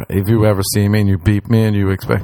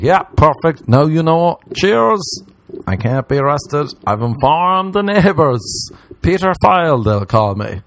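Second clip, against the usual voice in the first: breezy mock cheer; 6 semitones higher, slower than usual. His cheerful reply to neighbours.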